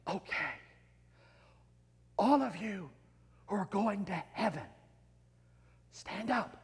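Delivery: hushed, soft call to the congregation; very quiet. Acted. Preacher quietly addressing the congregation, very soft. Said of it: "he says very quietly".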